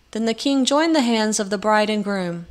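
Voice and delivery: plain reading voice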